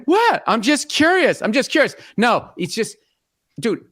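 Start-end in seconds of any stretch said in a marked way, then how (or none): 0.0-2.0 higher mock-innocent protest; hammed-up wife playing innocent. Husband mimicking wife denying she spoiled it, innocent. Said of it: "And she said".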